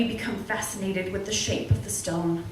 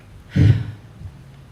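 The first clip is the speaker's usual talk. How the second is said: loud sigh; 9 dB louder than usual. Real, not acted. Nervous before reading.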